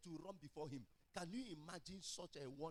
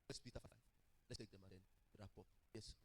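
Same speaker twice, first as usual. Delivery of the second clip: usual speaking voice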